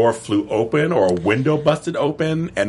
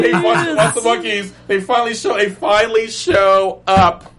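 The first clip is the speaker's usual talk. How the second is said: excited; 9 semitones higher, 4 dB louder than usual. Real, not acted. Excitement continues.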